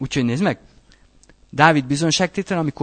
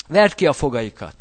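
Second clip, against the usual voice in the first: ordinary talk, nothing marked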